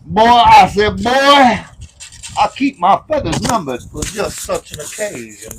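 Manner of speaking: put-on slow blustery rooster drawl, boy I said; Foghorn Leghorn. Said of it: "being Foghorn Leghorn there".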